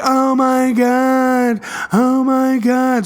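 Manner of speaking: slow, drawn-out gushing oh my God; excited gasp. Retold or imitated. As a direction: imitate the girlfriend swooning over the teddy bear. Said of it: "she's getting really excited".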